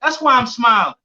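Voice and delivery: high, forceful comeback; proud retort. Retold or imitated. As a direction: fire back as a proud father. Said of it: "So me, I say".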